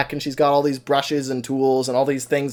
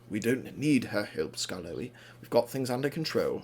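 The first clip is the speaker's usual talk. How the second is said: low, soft acted refusal; quiet dismissal; 5 semitones lower, 10 dB quieter than usual. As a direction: brush off a friend under your breath. Rheneas curtly refuses help at night.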